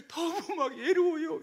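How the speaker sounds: high, whimpering mock plea; lonely seductress. Woman arousing men's sympathy, mock lonely whimper. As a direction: play up a pitiful, coaxing whine. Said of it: "이러거든요".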